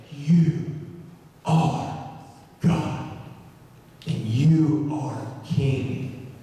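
halting, word by word with long pauses; awed confession. Retold or imitated. Nathanael now confessing Jesus as God, awed. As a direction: let each word land slowly, in wonder.

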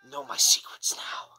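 soft conspiratorial whisper. Pretend. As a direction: hush it like a confession. Jokingly revealing a secret.

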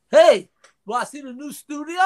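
bright, loud invitation; friendly greeting voice. Retold or imitated. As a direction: call out cheerfully. Retelling Gary's greeting.

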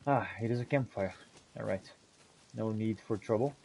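ordinary talk

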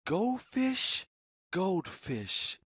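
ordinary delivery

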